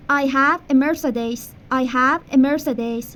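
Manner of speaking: slow teacher demo of the sentence; slow model sentence. Shown as a demonstration. Teacher modelling English for Persian students, slow. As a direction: say it slowly for repetition. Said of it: "مرسدس دارم".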